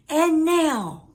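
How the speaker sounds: rally the crowd with a cry; cried out. A narrator's reading. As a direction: Max calling the monsters to begin. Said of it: "cried Max".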